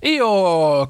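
loud, drawn-out call, falling away; crying out. A narrator's reading. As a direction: cry the name in astonishment. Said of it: "cried everybody".

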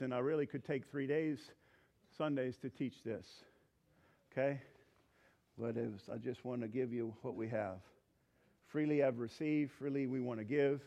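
tender pastoral hush. Real, not acted. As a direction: draw them in gently, heads bowed. Altar call, gentle invitation.

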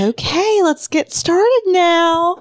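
high, slow, sing-song teacher. Retold or imitated. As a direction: talk like to small children. Teacher voice to children.

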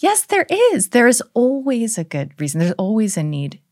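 mock patient mom; sing-song mother. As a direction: reassure the child in a lilting high voice. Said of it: "And I was like".

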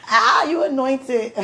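slow, loud emotional cry; overwhelmed sob of praise. Real, not acted. To wife, loud tearful slow praise. Overcome with emotion, crying heard.

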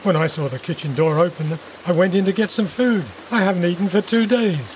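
low start, rising into defensive protest. A narrator's reading. Intruder explaining the break-in to Jess, defensive. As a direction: justify yourself as the hungry intruder.

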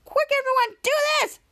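rally the crowd quickly; urgent rallying call. Retold or imitated. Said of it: "the spoiler said".

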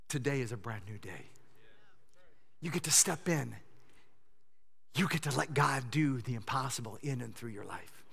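soft closing appeal. Acted.

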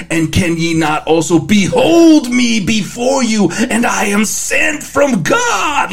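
hammy stage actor, big finish; hamming it up. Retold or imitated. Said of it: "hamming it up".

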